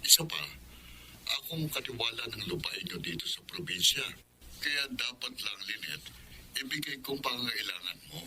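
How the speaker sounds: faint, distant voice through a phone line; over the phone. Acted. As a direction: sound like you're on the far end of a call. Uncle speaking over the phone.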